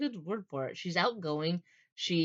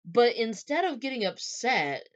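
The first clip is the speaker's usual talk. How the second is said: ordinary talk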